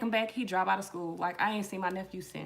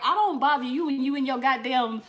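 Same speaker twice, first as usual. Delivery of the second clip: higher, louder mock scolding; hammed-up cussing at the cat; 6 semitones higher, 5 dB louder than usual. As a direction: tell the cat Desiree off. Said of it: "I was like".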